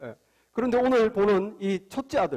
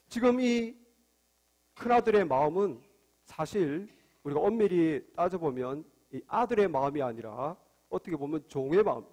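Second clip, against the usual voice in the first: ordinary talk, nothing marked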